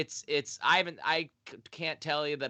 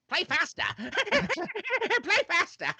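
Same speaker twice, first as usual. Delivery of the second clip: high, drawn-out frenzied cry; wild, laughing madman; Reefer Madness crazed voice; much slower than usual. Acted.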